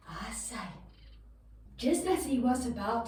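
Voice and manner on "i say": whisper. Shown as a demonstration. Sneaking past the giant.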